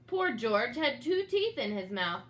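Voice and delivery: plain reading voice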